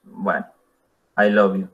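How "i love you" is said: I LOVE YOU low and level, unenthusiastic; neutral tone. Shown as a demonstration. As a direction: keep I LOVE YOU on one low note. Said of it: "Usando un tono neutral, simplemente decimos". (I LOVE YOU sin ganas, en tono neutro)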